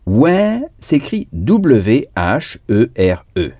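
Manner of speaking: ordinary talk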